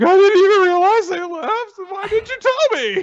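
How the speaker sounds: high, loud, whiny complaint; high whiny Taiki. Retold or imitated. Retelling Taiki's complaint to his friend as a high whine. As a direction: wail it like a flustered boy. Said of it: "And Taiki's like".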